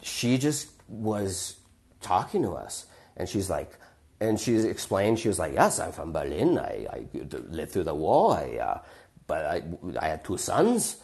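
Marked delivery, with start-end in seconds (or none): 5.5-11.0 put-on low, halting German-accented old lady; old German woman. Old woman explains her past to two boys, German accent. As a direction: imitate an elderly German woman, pausing between phrases. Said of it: "this German woman with a German voice".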